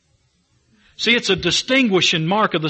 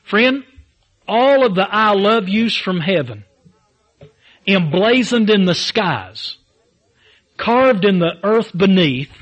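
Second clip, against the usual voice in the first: preaching build; 2 semitones higher than usual. Acted. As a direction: preach it up to the flames.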